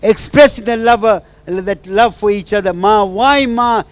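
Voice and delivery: high, loud, pleading and tearful; tearful plea to mother. Retold or imitated. Wife pours out her hurt to her absent mother, tearful and loud. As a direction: cry out to the mother who left her. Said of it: "And she was crying".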